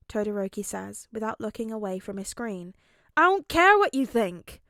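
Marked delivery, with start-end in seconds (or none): 3.2-4.6 loud gruff yell; angry shout. A narrator's reading. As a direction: snap it furiously. Katsuki snaps at Todoroki.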